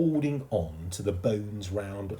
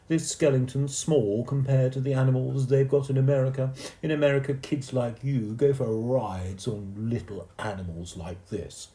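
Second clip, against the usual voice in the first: put-on boastful know-it-all boy; Timothy; 6 semitones higher, 5 dB louder than usual. A narrator's reading. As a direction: brag to the younger boy.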